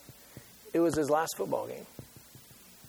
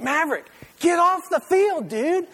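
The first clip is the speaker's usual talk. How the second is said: much higher, louder call to get off; yelling to the field; 11 semitones higher, 6 dB louder than usual. Retold or imitated. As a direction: yell it like a worried parent in the stands. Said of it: "I'm like".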